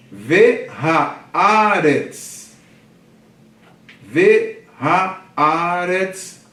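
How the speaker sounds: very slow, loud Ve haaretz with a long stressed A; syllable by syllable. Shown as a demonstration. Teacher counting four syllables for class, Ve haaretz very slowly. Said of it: "Quatro sílabas".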